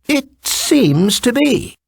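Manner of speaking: high, slow, amused fox king; a sly, smiling reply. A narrator's reading.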